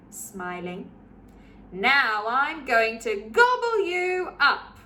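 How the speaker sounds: put-on lively read-aloud for children; story narration. A narrator's reading. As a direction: read it like a bedtime picture book. Picture book read aloud.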